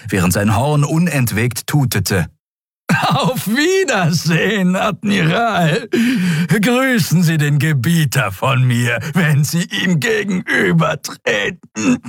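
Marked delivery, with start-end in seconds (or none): 3.1-12.0 high triumphant alien shout; mocking shout. Draxyl shouting a mocking goodbye as he dies. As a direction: crow the farewell, much higher.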